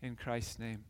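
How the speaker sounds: low, soft, earnest praying voice; prayer. Real, not acted. Pastor prays with congregation before the story, hushed prayer. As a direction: pray quietly and reverently, pitched well below preaching.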